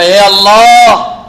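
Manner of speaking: loud, long-drawn invocation; a mother's drawn-out prayer call; imploring cry to God. Retold or imitated. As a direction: call out to God, stretching the words. Mother lifts hand, calls on God.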